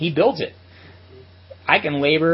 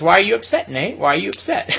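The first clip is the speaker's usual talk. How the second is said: higher, louder mock needling; teasing; 7 semitones higher, 5 dB louder than usual. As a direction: tease him in a raised, playful voice. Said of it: "He's kinda teasing me like this".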